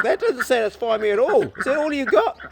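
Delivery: high, put-on voice for the unsatisfied female duck. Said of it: "And she's like".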